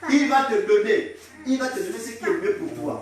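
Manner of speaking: loud, high declaration of blessing; forceful preaching. Real, not acted. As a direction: proclaim it boldly to the congregation.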